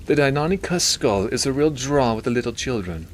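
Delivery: high nasally voice aspiring to sound British; Milton. A narrator's reading. Doctor objecting to being converted by the priest, nasally. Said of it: "He had a nasally voice".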